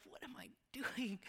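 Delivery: breathy unvoiced whisper, despairing. Son on the phone to his parents, whispering in despair. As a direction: whisper it like you're lost and alone.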